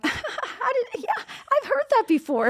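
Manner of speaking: high, giggly retold reply; imitating laughing clients. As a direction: squeal it through mock laughter. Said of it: "they normally bust out laughing, going".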